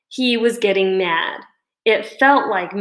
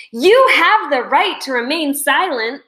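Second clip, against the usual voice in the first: loud and higher, like a TV cop; shouted declaration; 6 semitones higher, 4 dB louder than usual. A narrator's reading. Dave shouting to his classmates in the cafeteria. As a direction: proclaim it to the room. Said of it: "he shouted".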